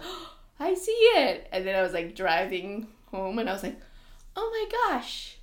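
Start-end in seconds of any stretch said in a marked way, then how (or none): 0.0-1.5 loud, high burst of discovery; excited realization. Retold or imitated. Acted moment of spotting hidden image. 4.4-5.4 loud, very high squeal of amazement; astonished discovery. Retold or imitated. Retells own astonished discovery while driving.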